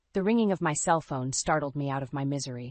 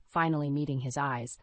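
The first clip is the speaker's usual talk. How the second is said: usual speaking voice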